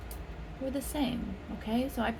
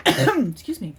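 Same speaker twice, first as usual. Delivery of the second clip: gasp; 5 dB louder than usual. Real, not acted. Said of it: "from doing that funny accent".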